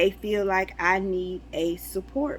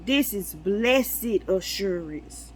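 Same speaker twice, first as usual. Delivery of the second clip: preaching; slower than usual. Acted. Preaching a revelation.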